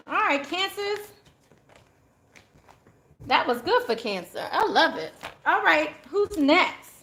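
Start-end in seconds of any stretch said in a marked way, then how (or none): none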